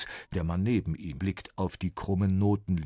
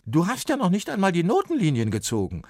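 louder, higher, fast scolding; 12 semitones higher, 7 dB louder, faster than usual. A narrator's reading. Copyist scolds Sven for missing lines. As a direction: scold the clumsy boy. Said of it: "schimpft er".

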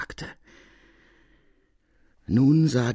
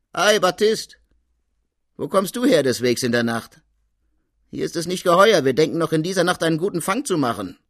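much higher, louder jovial officer; officer Degré; 9 semitones higher, 7 dB louder, faster than usual. Acted. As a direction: hail him with gruff good humour. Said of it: "Der spricht".

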